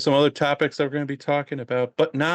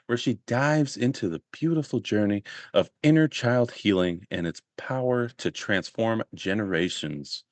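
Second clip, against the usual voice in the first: ordinary talk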